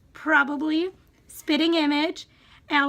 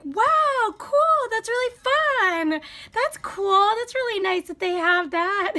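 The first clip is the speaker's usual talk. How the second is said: much higher, delighted exclamations; mom's excitement; 6 semitones higher, 3 dB louder, slower than usual. Retold or imitated. Mom hearing of the bubbler, thrilled high gushing. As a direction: gush like mom picturing a splash fountain. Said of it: "And my mom was like".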